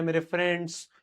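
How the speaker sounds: ordinary talk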